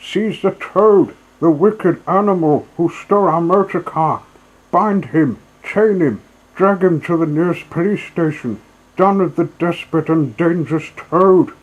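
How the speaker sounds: rally the mob against the thief; angry party shouting to seize Toad; outraged cry. Acted. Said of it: "they cried".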